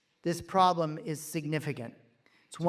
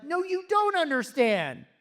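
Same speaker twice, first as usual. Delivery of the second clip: sudden loud high outburst; retold yell; 10 semitones higher, 5 dB louder than usual. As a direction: burst out loud and high, insisting.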